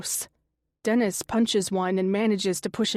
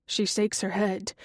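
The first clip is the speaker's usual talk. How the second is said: plain reading voice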